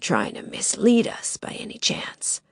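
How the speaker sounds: masked intruder taunting Lily, whispered and menacing. Acted. Sinister whispered taunt.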